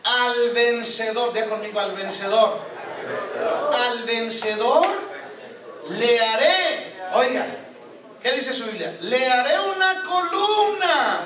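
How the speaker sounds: loud, high, drawn-out proclamation; full-force preaching. Real, not acted. Preacher reading Revelation to his congregation, full-force proclaiming. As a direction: thunder the verse out slowly, stretching key words.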